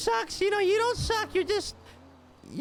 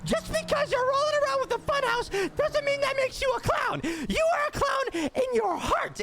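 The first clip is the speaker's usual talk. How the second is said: raised, high-pitched scolding rant; mock lecture; 5 semitones higher than usual. Comedian scolding co-host in a hammed-up shouting rant. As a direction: lay into him like a fed-up coach.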